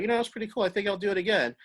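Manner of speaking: a hint of a pleasantly won-over walker; imagined first-time walker. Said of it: "people come on it for the first time".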